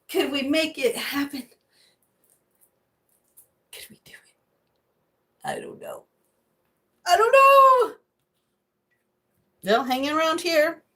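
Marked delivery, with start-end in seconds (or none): 3.7-6.0 unvoiced hushed doubt; whispered uncertain musing. Real, not acted. Wondering quietly. 7.0-7.9 very high loud squeal; high loud comic squeal. Real, not acted. Repeated, squealed.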